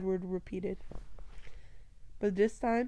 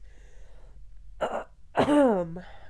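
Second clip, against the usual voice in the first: throat clearing; 5 dB louder than usual. Real, not acted. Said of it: "I lost my voice".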